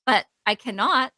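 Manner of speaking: ordinary talk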